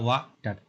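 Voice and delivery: "Water" split into two separate beats, वा, then टर; broken into syllables. Shown as a demonstration. Teacher showing students two syllables, "water" split as वा-टर. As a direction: say "water" with a clear gap between वा and टर. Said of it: "ऐसे जो तोड़ते हो ना".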